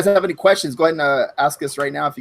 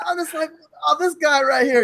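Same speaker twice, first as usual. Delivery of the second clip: high-pitched telling broken by laughter; laughing while telling; 9 semitones higher than usual. Real, not acted. Friend retells an embarrassing phone call, laughing. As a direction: giggle through the retelling.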